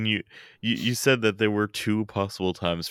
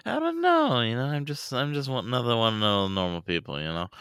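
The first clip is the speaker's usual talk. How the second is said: mock modesty; 5 semitones higher than usual. Feigning modesty about elite status.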